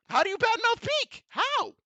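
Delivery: how could anyone, squeaked high; high, disbelieving cry of how; incredulous high squeak. Real, not acted. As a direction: pitch shoots way up in disbelief. Incredulous repeated question.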